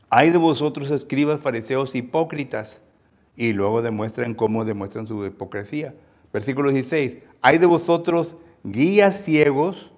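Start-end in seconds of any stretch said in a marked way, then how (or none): 0.1-2.8 loud start that settles; rebuking woe. Acted. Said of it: "Jesús aquí les está hablando". 7.4-9.9 loud, high, slow denunciation; scathing rebuke. Acted. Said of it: "Jesús aquí les está hablando".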